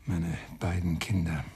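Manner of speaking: quiet, slow, broken murmur of a grieving father; stricken grief. Acted. Father seeing his dead son at the morgue, hushed and stricken. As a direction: let the words almost fail you.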